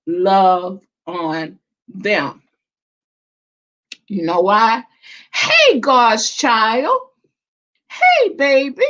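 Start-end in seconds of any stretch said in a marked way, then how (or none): 5.3-8.8 sing-song greeting. Real, not acted. Greeting a viewer on live stream.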